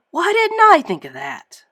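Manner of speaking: high, loud annoyed outburst; frustrated Plankton. A narrator's reading. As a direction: stamp your foot and whine. Plankton stamps his foot.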